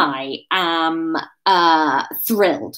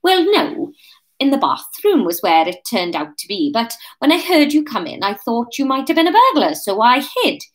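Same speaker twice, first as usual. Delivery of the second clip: put-on higher, lilting Welsh accent; character voice in Welsh; 5 semitones higher, 3 dB louder than usual. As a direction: pull it firmly back to Wales.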